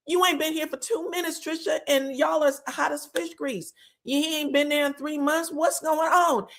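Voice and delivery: high-pitched, insistent questioning; incredulous doctor. Retold or imitated. Recapper playing the doctor confronting Trisha, incredulous. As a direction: pitch it up in disbelief.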